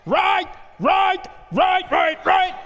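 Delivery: loud, high, drawn-out parade-ground commands; general yelling orders. Acted. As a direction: bellow marching orders like an army general. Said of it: "a general yelling out".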